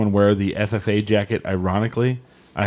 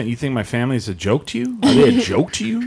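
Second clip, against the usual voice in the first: hammed-up indignation, climbing much higher; mock offended; 3 semitones higher than usual. Man confronting stranger over his family, hammed-up offence. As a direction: play wounded family pride, pitch leaping up. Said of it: "I kinda got offended".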